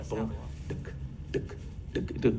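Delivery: softly whispered boom and ticks like a basketball bouncing; ball bouncing sound. Retold or imitated. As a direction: tap out the ball's beat under your breath. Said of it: "He's making that sound".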